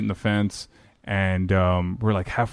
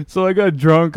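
laughing; 9 semitones higher, 3 dB louder than usual. Real, not acted. Laughing at funny story.

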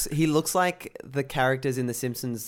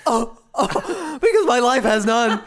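high, loud, hammed-up wail of despair; mock anguish; 10 semitones higher, 7 dB louder, slower than usual. Mocking himself for a friend's probing question, hammed-up high wail. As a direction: overact being cracked open, pitched way up. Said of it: "I'm just like".

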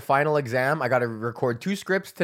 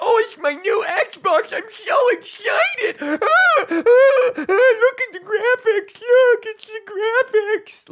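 very high, slow, laughing half-sob squeal; mock overexcited gamer; 7 dB louder, slower than usual. Mocking console buyers unboxing an Xbox, in an overexcited squeal. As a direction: ham up a gamer near tears over his new Xbox.

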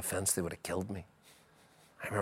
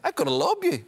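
very high, loud goading; mocking trash-talk call; retold taunt; 5 dB louder than usual. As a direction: crow it at the keeper, pitched right up.

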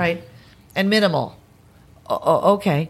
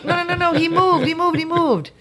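high-pitched, rapid-fire repeated objection; insistent protest; 10 semitones higher than usual. Retold or imitated. Actress protesting to the director who called cut, insistent. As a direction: insist urgently, as if nobody believes you.

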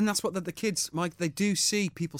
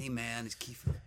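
Kiefer impression; 4 semitones lower, 11 dB quieter than usual. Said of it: "echoing Kiefer on the phone".

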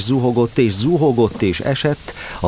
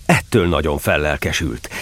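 usual speaking voice

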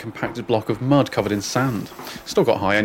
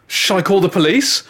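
mum; 8 semitones higher, 5 dB louder than usual. Retold or imitated. Mum voiced through door.

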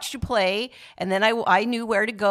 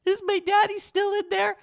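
put-on high small child asking for daddy; high, timid child asking after her dad; little girl voice; 13 semitones higher than usual. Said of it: "And I said".

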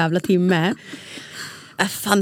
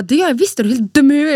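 higher, louder mock outrage; retold angry retort; 6 semitones higher, 4 dB louder, faster than usual. Arguing with husband at night, imitating her own anger. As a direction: snap back indignantly. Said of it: "Jag bara".

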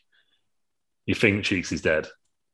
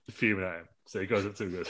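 ordinary talk, nothing marked

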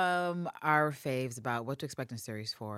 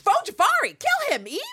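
much higher and louder, egging her on; excited cheer; 9 dB louder than usual. Real, not acted. Fan cheering Eve to attack the man, excited shout. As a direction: squeal it like a fan at the screen.

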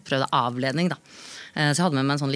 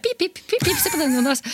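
very high sing-song dog talk; high cooing voice to distract a barking dog; baby talk to the dog; 12 semitones higher than usual. Retold or imitated. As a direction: coax the dog in a squeaky voice. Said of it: "så sier jeg".

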